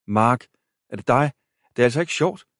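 getting spooked, voice climbing; rising alarm. A narrator's reading.

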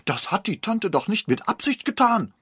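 high, loud defensive protest; upset godmother. A narrator's reading. The godmother defends herself to the mother, high and loud. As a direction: still shouting, defending herself. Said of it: "rief die Patin aufgebracht".

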